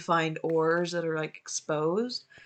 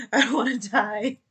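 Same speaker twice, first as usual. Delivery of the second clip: scared; 5 semitones higher, 6 dB louder than usual. Real, not acted. Attacked in the cave.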